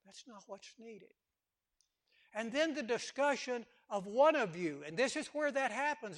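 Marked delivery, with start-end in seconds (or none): 0.1-1.1 repeated much softer; preacher repeating the point in a hush; soft echo. Shown as a demonstration. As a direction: let it sink in quietly.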